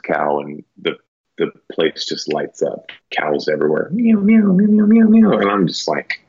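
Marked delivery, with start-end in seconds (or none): 3.9-5.4 high mewing cow elk calls, much higher and louder; squeaky high elk mews, mouthed like a reed call. Retold or imitated. Imitating lost cow elk calls.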